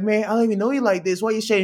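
high, incredulous push-back. Retold or imitated. To the oversharing supervisor, high incredulous disbelief. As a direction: question him in disbelief. Retelling reply to oversharing stranger.

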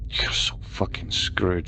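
slow, whispered gloating taunt; taunting cheer. A narrator's reading. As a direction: savour it in a hushed sing-song. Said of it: "she cheered with a tune hanging in her voice".

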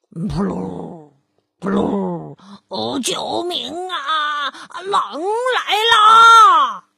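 sobbing, rising to a loud slow scream; terrified cry for help. Acted. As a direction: panic as the real wolf appears. Wolf cries for help.

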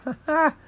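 high-pitched "ha, ha"; acted laugh. A narrator's reading. Jenkins laughs off the clerk's news, high put-on chuckle. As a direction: fake a short high laugh. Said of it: "Jenkins laughed".

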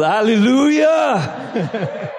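long, loud, rising cry of praise; shouted hallelujah. Real, not acted. Preacher shouts hallelujah to the church, full voice. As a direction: shout it out to the congregation. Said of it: "Now I can really shout".

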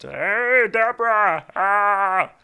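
loud, high hollering impression; Ray Romano. Husband calling his wife, loud hammed-up impression. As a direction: holler it in a strained comic voice. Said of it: "is Ray Romano".

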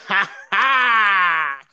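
loud, high mocking ha ha; hammed-up taunting laugh; mocking laugh shout. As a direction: cackle at him like the guy barging in. Acting Dak laughing in locker room.

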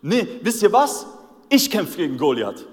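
lauter, höher trotziger Entschluss; entschlossener Ausruf. Retold or imitated. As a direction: mutig vortreten und es laut sagen. David verkündet trotzig seinen Entschluss. (David verkündet laut, dass er kämpft)

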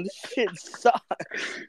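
very slow, dragged-out disgust; mock disgusted Jefferson. Friend voicing Jefferson judging modern music, slow disgust. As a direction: drawl it out in contempt. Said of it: "Thomas Jefferson be like".

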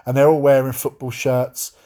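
usual speaking voice

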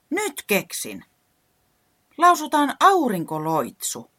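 high, loud, slow with eagerness; excited suggestion. Acted. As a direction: announce the plan with glee. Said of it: "Reporankka ehdotti". (innoissaan ehdottaen)

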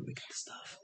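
hushed conspiratorial whisper; sneaky whispered confirmation. Retold or imitated. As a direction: breathe it like a secret deal. Secretive reply about fake cocaine.

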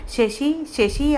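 high, tender call of a worried mother; mother calling softly. A narrator's reading. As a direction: call his name gently to wake him. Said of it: "అమ్మ గొంతు పిలుస్తూ". (ఆప్యాయంగా పిలిచే అమ్మ)

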